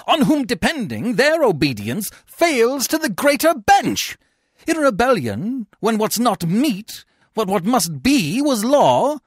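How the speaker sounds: high, loud theatrical denunciation; furious tirade. A narrator's reading. Coriolanus rails against the tribunes.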